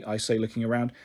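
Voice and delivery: ordinary delivery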